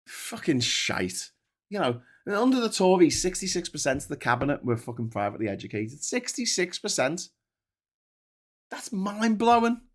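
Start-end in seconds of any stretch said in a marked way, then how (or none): none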